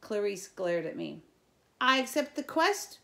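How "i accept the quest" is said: high, loud and proud; loud proud declaration. A narrator's reading. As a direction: declare it to the whole camp. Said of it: "she repeated".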